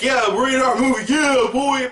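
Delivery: put-on half-hearted yeah boy; mock hype. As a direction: fake cheer for gore. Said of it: "I should be like".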